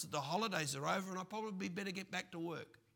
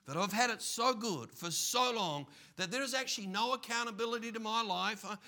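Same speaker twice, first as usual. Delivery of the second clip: louder, higher self-assured boast; smug careless man; 4 semitones higher, 6 dB louder than usual. Acted. Preacher voicing the man who thinks life never ends, cocky. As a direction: boast like nothing can touch you.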